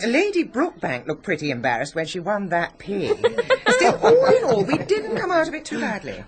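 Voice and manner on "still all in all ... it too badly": laughing. Acted. Laughing over fete results.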